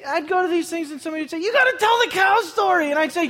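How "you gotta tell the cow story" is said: eager urging. Retold or imitated. Friends urging the story.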